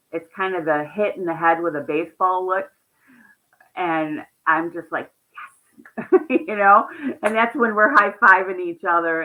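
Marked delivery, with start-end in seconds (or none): 5.3-9.2 loud, laughing cheer of triumph; excited yes. Retold or imitated. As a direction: celebrate the win, laughing. Celebrating breakthrough with veteran.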